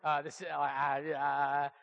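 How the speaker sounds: low, halting imitation of someone cornered; mock stammering reluctance. Pastor cornered by a party guest on New Year's, mock flustered reluctance. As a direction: fumble for words in a low voice, backing out. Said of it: "I was just like".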